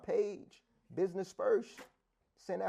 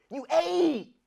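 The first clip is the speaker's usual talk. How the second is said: accusing yell; 9 semitones higher, 8 dB louder than usual. Retold or imitated. Catching partner lying.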